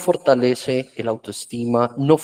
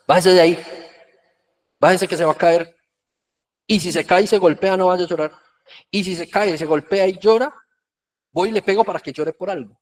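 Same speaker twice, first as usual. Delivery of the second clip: harsh scolding parent; 5 semitones higher, faster than usual. Retold or imitated. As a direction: bark orders down at a child on the playground. Old-style parent yelling at child.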